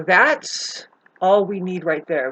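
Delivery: normal talking voice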